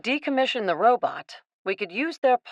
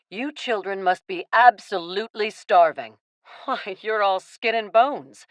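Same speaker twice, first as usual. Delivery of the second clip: usual speaking voice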